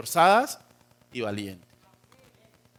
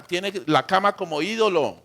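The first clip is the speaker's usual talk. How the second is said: normal talking voice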